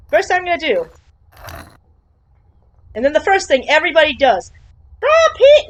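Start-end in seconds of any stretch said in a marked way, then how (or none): 5.0-5.7 mock eager cry. Mocking what every player draws.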